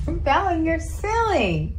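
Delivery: high, slow cooing at a pet. Real, not acted. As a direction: coo to the cat. Owner baby-talks to her cat.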